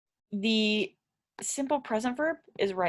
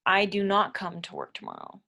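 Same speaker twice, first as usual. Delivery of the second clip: "not" stressed in a near whisper. Shown as a demonstration. Teacher showing learners the negative with "not", whispered. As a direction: breathe the sentence out, leaning on "not". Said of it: "That's putting the do not".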